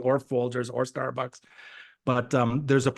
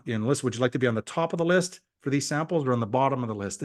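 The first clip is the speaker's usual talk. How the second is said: ordinary talk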